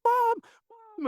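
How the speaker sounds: very high-pitched put-on child voice; squeaky mock boy calling out; little boy. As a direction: imitate a kid calling for his mom. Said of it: "I heard a little boy".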